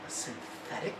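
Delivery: hushed, startled question; a doctor gasping as her eyes go wide; wide-eyed surprise. A narrator's reading. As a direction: breathe it out in disbelief. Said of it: "the doctor's eyes go wide and she says".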